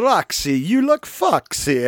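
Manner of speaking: put-on Italian accent, oily come-on, rising then stretched out; sleazy Italian pervert. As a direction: do a hammy Italian lech. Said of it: "Armand is this kind of".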